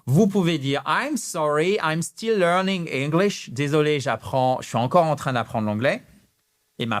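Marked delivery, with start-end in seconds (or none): none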